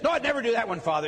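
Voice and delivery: hammed-up innocent protest; protesting penitent. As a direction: play a penitent hastily disowning that sin. Penitent protesting during list of sins.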